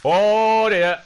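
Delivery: high, loud startled "oh dear"; alarmed cry. Real, not acted. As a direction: cry out at sudden danger. Sudden danger in game.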